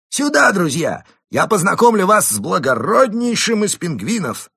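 high, loud call across the tavern. A narrator's reading. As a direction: shout to his friends. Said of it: "крикнул остальным".

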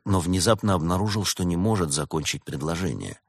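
ordinary delivery

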